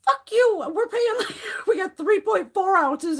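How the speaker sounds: high-pitched indignation through laughter; laughing outrage. Real, not acted. Woman rants about men's cologne sizes, laughing and outraged. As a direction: protest loudly, half laughing.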